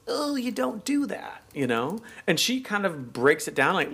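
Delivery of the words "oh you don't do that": mock prim disapproval. Mock British disapproval of money talk.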